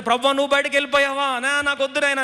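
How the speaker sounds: high-pitched, urgent plea to God; pleading cry. Acted. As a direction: cry out as Job to the Lord. Acts Job crying out to God. (ఆర్తితో వేడుకోలు)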